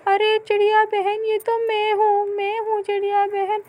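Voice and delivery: high, loud hurried pleading; pleading neighbour. Acted. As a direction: call out quickly so she stops. Lado calls out to bird. (ऊँची घबराई पुकार)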